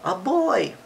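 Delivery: high bear's exclamation; scared exclamation. Shown as a demonstration. Quoting the scared bear for a child, exclaimed. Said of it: "like the bear said".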